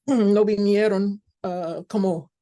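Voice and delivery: usual speaking voice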